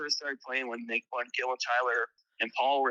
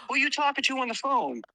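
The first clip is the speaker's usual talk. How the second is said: much higher, curious imitation; 12 semitones higher than usual. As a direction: call out a casual question, pitched up. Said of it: "And it was fucking [name]".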